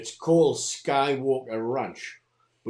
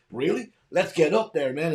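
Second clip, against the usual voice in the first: high, loud, eager American dude; mock excitement; 5 semitones higher, 7 dB louder than usual. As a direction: act out a gung-ho ghost hunter raring to go. Acting out excited ranch investigators.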